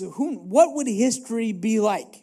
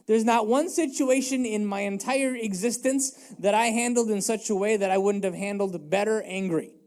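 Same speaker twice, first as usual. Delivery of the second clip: ordinary talk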